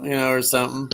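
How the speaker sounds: ordinary talk, nothing marked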